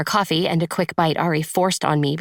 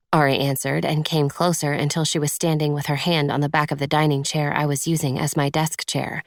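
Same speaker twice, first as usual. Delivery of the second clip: usual speaking voice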